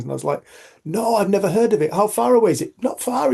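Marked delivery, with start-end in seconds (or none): 0.8-2.7 higher, louder, eager surprise; surprised. Retold or imitated. Acting his own surprised reply to kid. 2.8-3.3 lightly voiced young boy, much higher; boy's voice. Voicing the boy's answer.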